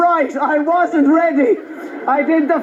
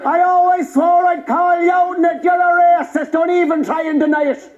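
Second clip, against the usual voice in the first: mock indignant Traveller call-out; Irish Traveller accent. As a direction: accuse him in a Traveller accent. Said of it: "two travelling young fellas".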